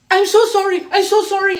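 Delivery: high, loud, repeated mock-frightened pleading; panicked apology. Man in car apologising before he skirts away, mock panic. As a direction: imitate someone terrified, hurrying to apologise.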